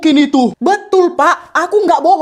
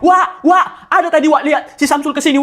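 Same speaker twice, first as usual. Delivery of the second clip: ordinary delivery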